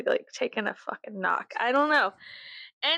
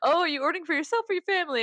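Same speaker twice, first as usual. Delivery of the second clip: higher, fast bright customer-service voice; friend's voice; 7 semitones higher, faster than usual. Retold or imitated. Her homegirl at the restaurant, a light chirpy voice. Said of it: "she's like".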